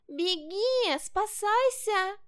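high-pitched little fox crying out in alarm; breathless fox cub shouting. A narrator's reading. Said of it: "запыхавшийся лисёнок и закричал". (крик запыхавшегося лисёнка, высоко и громко)

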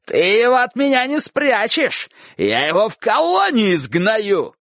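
high indignant yell, slowing at the end; shouted threat. A narrator's reading. Dog-catcher threatening aunt with whip, shouting. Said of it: "кричал Собаколов".

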